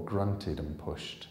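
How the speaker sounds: usual speaking voice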